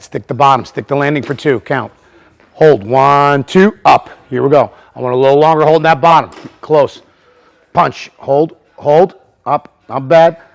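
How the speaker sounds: loud, high counting of the hold; coaching count. Real, not acted. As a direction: call the count across the gym. Coach counting holds aloud.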